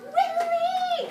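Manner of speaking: high loud joyful shout of the name; cheering the dog as he makes it; excited cry. Real, not acted. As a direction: cheer him home. Cheering the dog's escape.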